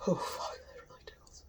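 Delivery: hushed tickled whisper; breathy whisper through a nose tickle. Real, not acted. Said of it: "that tickles".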